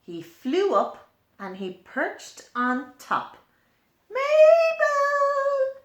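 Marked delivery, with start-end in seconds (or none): 4.1-5.8 high, loud little bird call; high bird voice calling a name. A narrator's reading. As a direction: call out like a tiny bird from the wall. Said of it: "he called".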